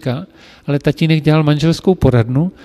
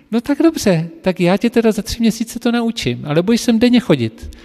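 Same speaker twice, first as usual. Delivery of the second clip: much higher, amused and indulgent; amused mistress; 8 semitones higher than usual. Retold or imitated. Amused mistress accepting the boastful apprentice's challenge. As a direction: agree to his challenge with a high amused voice.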